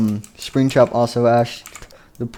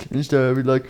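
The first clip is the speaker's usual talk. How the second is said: ordinary delivery, nothing marked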